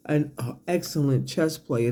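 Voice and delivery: ordinary delivery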